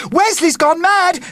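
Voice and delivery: high loud alarmed shout. Acted. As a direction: shout it in shock. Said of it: "shouted Carly".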